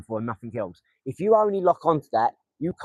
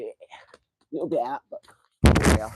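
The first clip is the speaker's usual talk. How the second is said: whispered aside; 4 semitones lower, 3 dB louder, slower than usual. Real, not acted. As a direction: breathe it out under his breath. Commenting quietly on dart throw.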